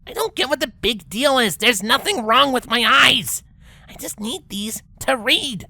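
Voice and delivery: high brash pegasus voice; Rainbow's voice. Acted. Rainbow insists to Twilight her eyes are fine, high and defensive.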